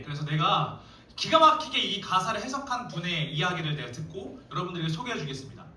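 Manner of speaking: ordinary talk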